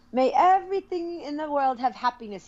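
a hint of an Indian accent; imitating Indian English. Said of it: "he always goes".

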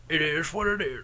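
soft put-on voice. Shown as a demonstration. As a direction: try the catchphrase in a quiet funny voice. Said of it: "How about if I went".

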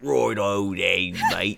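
slow, loud Cockney "righto then, mate"; broad Cockney mate; Cockney accent. Acted. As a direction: lay the Cockney on thick. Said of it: "that super Cockney accent".

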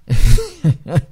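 loud burst of laughter; sudden real laugh. Real, not acted. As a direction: burst out laughing before explaining. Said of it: "I'm laughing".

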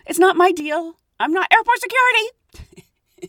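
high, emphatic inner protest; acting out a firm no to herself; mock refusal. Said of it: "kinda thinking to myself".

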